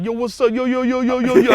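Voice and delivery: high, loud hammed-up "yo yo yo" greeting; cohost's off-camera voice. Teasing his cohost about how he talks off camera, hammed-up loud "yo". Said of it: "I talk way blacker than this off camera".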